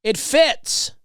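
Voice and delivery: high, slow, loud sing-song gloat. Retold or imitated. Loudly gloating so the rude lady hears, high and drawn out. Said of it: "but I did loudly say".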